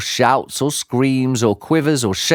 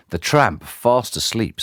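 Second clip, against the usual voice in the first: ordinary delivery, nothing marked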